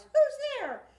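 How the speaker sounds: startled mouse cry. Acted. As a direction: cry out like a frightened little mouse. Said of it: "Mouse cries".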